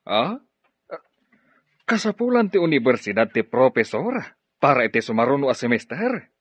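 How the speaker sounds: ordinary talk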